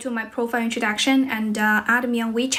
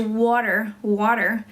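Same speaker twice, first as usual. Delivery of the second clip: American accent; slower than usual. Shown as a demonstration. English teacher contrasting the classic example, American accent. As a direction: say "water" American-style, with a final r. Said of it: "然后American是".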